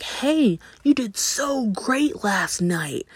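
high, slow gushing praise; mock gushing teammates; team gushing. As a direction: lay it on thick, like fans. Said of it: "his team is like".

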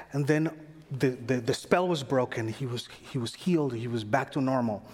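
ordinary talk, nothing marked

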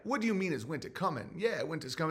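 soft, quick puzzled reply. Acted. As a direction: drop the volume, play him mildly baffled. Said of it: "And he's like".

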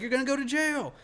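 high-pitched mock threat; acted-out heated taunt; retold taunting yell. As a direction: holler a warning, replaying the standoff. Retold shouted threat to attacker.

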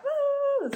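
put-on high-pitched girly "woo". Showing followers her fun girly side, high girly woo. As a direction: let out a squealed party woo. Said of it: "like, a girly girl".

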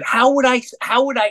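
high-pitched, incredulous retort; retold exasperated insistence; insistent protest. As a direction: protest it high, tripping over the words. Retold protest to doubting friend.

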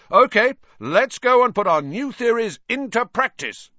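high, loud rallying shout; captain's full-voiced call to practice. A narrator's reading. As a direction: shout it to rouse the team. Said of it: "Wood shouted".

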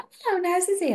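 high sing-song greeting; chirpy Chatterbox. Retold or imitated. Grandma's chatty friend greeting the sisters, high and sing-song. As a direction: chirp it like an overfriendly visitor. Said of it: "she was like".

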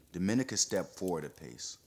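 ordinary delivery

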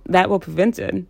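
normal talking voice

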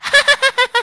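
high, loud, exaggerated giggle; mock girly laugh. Mocking how everyone reacts to a girl's joke with a loud fake laugh. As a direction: burst into a shrill fake cackle. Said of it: "everyone's like".

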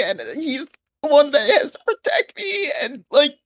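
put-on very high, wailing, sobbing child voice; hammed-up crybaby child plea; fake crying little kid. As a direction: beg the guard like a lost little kid, fake tears. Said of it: "I start crying a little bit".

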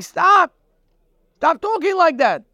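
high, loud cry to stop; urgent command. Acted. Caleb silencing the spies.

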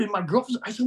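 usual speaking voice

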